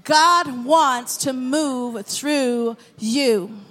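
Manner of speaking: drawn-out, word-by-word declaration; slow emphatic repeat. Shown as a demonstration. Preacher repeats the line for the congregation, slowly and emphatically. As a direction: let each word of "God wants to move through you" stretch out.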